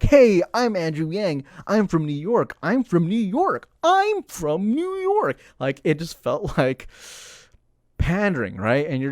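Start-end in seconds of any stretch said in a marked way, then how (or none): 0.0-3.7 louder, higher mock campaign pitch; lightly voiced eager politician; mock candidate pitch. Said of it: "he's trying to be like". 3.8-5.4 drawn-out, very high mocking "I'm from New York"; hammed-up slow sing-song pandering; mock candidate. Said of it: "he's trying to be like".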